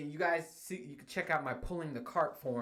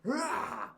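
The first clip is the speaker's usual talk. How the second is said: mock battle roar; 13 semitones higher, 4 dB louder than usual. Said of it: "I'll go".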